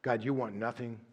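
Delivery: low, soft, unhurried prayer voice; closing prayer. Acted. Pastor closes the sermon praying over the congregation, hushed and low. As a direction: pray it quietly, with long pauses.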